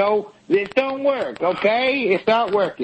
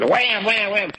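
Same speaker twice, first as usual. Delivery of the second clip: ordinary talk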